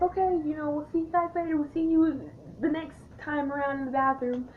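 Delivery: high, bubbly retold farewell; cheery goodbye. Parting from new bathroom best friends, high and cheery. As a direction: imitate a chirpy see-you-later.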